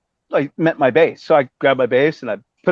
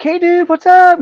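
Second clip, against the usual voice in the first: much higher, louder, in a put-on surfer-dude voice; high dude greeting; 6 dB louder, slower than usual. Vince greeting him in the parking lot, high hyped dude voice. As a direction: pitch it way up like an excited rock guy.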